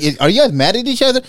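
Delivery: high, loud imitation of a worried question; alarmed wife. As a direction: mimic an alarmed wife, voice jumping up.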